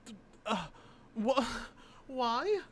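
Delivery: high, stammering incredulity; splutter, lost for words; real stammering shock; stunned disbelief. Real, not acted. Stunned by the shirt.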